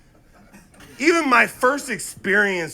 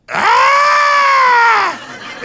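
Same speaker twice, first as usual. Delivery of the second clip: very high, loud crow squawk; long piercing caw in imitation of mom; crow-like mom screech; 9 dB louder than usual. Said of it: "voice where she goes".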